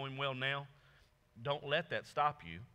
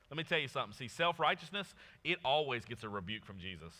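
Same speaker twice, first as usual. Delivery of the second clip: ordinary talk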